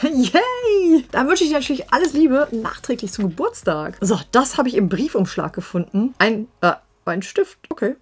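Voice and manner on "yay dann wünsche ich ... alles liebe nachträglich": high, loud little yay; excited cheer. Real, not acted. Reading a fan's birthday note, excited cheer.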